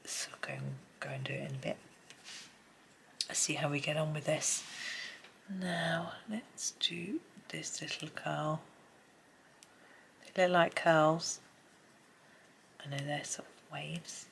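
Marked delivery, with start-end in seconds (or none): 0.1-1.8 quick soft whisper. Real, not acted. Quiet aside while colouring. 5.5-8.6 slow soft whisper. Real, not acted. Whispered while colouring. 12.8-14.3 quick soft whisper. Real, not acted. Whispered while colouring.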